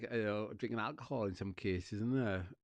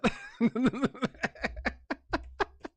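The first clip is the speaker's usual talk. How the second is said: long high cackle; loud high laugh; 9 dB louder than usual. Real, not acted.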